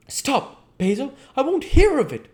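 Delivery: high, loud outcry; cried out. Acted. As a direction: cut him off with a cry. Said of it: "cried Dorian".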